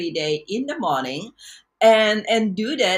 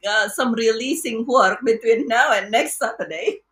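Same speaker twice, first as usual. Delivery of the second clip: higher, louder, laughing through the words; laughing quote; 6 semitones higher, 3 dB louder than usual. Retold or imitated. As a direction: chuckle along as you promise. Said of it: "And so I said".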